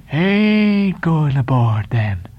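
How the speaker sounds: very slow, drawn-out questioning; Elijah's voice. A narrator's reading. Elijah pressing the sailors about boarding, very slow. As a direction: stretch the words like an eerie stranger.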